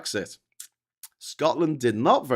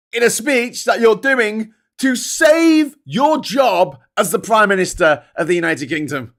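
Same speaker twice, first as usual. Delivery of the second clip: high and loud, dragging the words out; fed up, almost shouting at him; exasperated rant; 7 semitones higher, 9 dB louder than usual. Real, not acted. Ranting at the Prime Minister's speech, loud exasperated disbelief.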